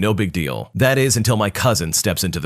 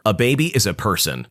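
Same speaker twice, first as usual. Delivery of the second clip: plain reading voice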